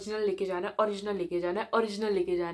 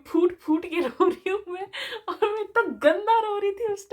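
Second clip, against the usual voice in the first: words broken by real laughter, a little higher and louder; amused giggle through the line; laughing while telling; 9 semitones higher, 4 dB louder than usual. Real, not acted. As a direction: laugh at your own past drama. Laughs while recalling her sobbing.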